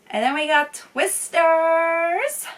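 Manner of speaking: high, loud, drawn-out film reveal; excited announcement. Real, not acted. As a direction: belt out the title, stretching it long.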